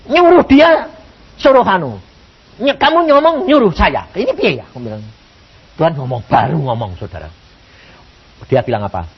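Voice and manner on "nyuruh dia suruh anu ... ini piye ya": high, insistent protest; exasperated bewilderment. Retold or imitated. Preacher questioning God's contradictory orders, exasperated. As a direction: argue helplessly with God in prayer. Acted bewildered complaint to God.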